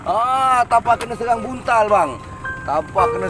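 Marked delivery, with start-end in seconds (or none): none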